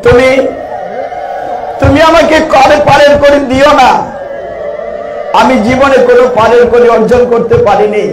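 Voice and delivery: high, loud impassioned sermon; boatman's tearful plea, preached loud; preaching at full force. Acted. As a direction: cry it out to the devotees.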